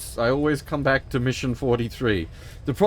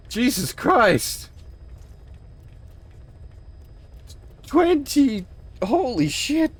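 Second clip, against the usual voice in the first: excited; 6 semitones higher than usual. Real, not acted. Reacting to gifted subs and game.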